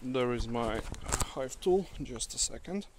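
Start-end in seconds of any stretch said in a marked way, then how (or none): none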